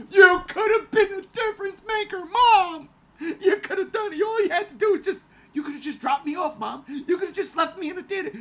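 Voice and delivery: high, loud wailing plea with laughing sobs; hammed-up tearful complaint, yelling at mom; mock crying plea. To his mother about missing Godzilla, mock sobbing shout. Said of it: "I say all the time".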